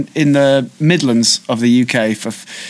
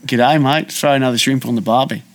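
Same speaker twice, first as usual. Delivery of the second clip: Australian accent; 2 semitones lower than usual. Shown as a demonstration. Showing a co-host a party-piece, in a quick Australian accent. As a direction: rattle it off like a stock Aussie greeting. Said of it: "You can do Australian quite well".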